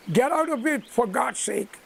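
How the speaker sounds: ordinary delivery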